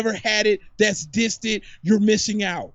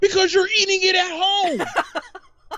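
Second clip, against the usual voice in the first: whiny mock; 8 semitones higher than usual.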